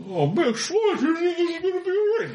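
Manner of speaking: high, whimpering pained voice; pained guard. Acted. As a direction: whimper through the pain, high.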